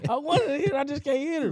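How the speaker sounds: laughing high through the words. Real, not acted. Telling friends he wanted to hit her, laughing. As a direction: laugh while confessing it. Laughing at his own story.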